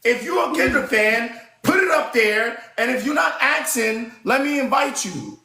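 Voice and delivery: high, exasperated pleading instructions; annoyed. Real, not acted. Host telling [name] fans how to get invited, exasperated. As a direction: lay it out for them in a high, fed-up voice. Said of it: "'Cause y'all annoying me".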